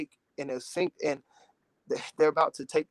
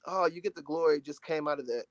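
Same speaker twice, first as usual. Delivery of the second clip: normal talking voice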